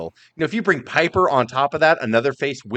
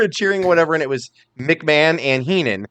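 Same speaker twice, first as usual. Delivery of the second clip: ordinary talk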